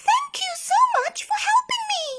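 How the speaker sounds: very high, fast bird voice; grateful bird. A narrator's reading. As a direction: chirp out thanks. Tired bird thanks them.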